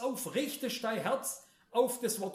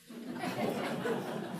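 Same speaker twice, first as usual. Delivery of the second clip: short voiceless laugh; breathy chuckle; 5 semitones higher than usual. Real, not acted. Preacher amused by his remark on disappointing believers, breathy chuckle. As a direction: chuckle at your own remark.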